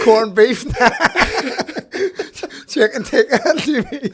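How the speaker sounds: loud, high words breaking into giggles; laughing mock quote. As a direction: list the fillings while cracking up. Listener riffs while cracking up.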